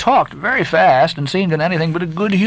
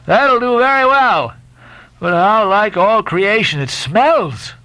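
higher, slow sailor voice over the stink; Stubb holding his nose; 7 semitones higher, slower than usual. A narrator's reading. Stubb holding his nose, scoffing at the smelly ship. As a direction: savor the joke while the stench hits.

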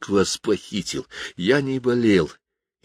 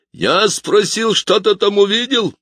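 much higher, slow and louder, menacing showman; piper; 13 semitones higher, 4 dB louder, slower than usual. Acted. Piper demands an answer from the boy, slow and looming. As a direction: lean on each word like a stage villain. Piper repeats his question.

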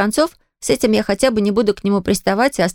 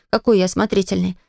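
ordinary delivery, nothing marked

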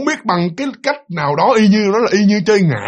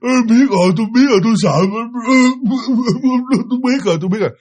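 frightened pleading; 6 semitones higher, faster than usual. Retold or imitated. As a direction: cry out in fear, begging to be let go. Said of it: "cái giọng năn nỉ".

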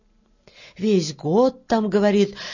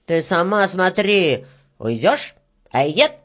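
threatening retort; 4 dB louder than usual. Acted. Red-haired worker warns the beetle back, threatening. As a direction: throw the warning back forcefully in usual pitch.

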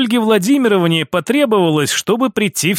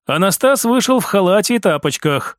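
usual speaking voice

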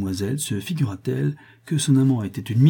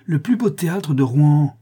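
loud, high-pitched indignation; cri indigné; 6 semitones higher, 7 dB louder than usual. A narrator's reading. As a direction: protest proudly with raised voice. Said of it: "cria la chanteuse indignée". (indignée, voix haute et forte)

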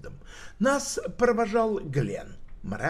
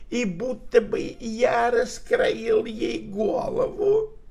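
put-on whining old man; 6 semitones higher, 3 dB louder than usual. A narrator's reading. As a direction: wheedle like a pitiful old drunk. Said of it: "заныл скулящим тоном".

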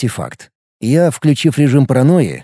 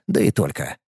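ordinary delivery

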